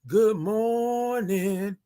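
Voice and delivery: long sing-out morning call; drawn-out greeting. Shown as a demonstration. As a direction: stretch the greeting way out. Signature drawn-out greeting again.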